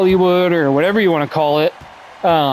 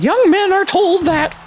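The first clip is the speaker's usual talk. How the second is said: much higher, slow mock Jordan Peterson voice; Jordan Peterson impression; slower than usual. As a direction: send up Jordan Peterson lecturing. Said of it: "it's not a very good Jordan Peterson accent".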